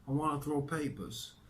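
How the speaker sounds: put-on high-pitched New York accent. A narrator's reading. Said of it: "he said in his New York accent".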